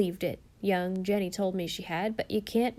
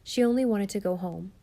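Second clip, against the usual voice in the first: ordinary delivery, nothing marked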